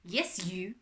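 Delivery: put-on French-accented butler reply. A narrator's reading. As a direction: confirm curtly as a French butler. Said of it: "It was a French accent".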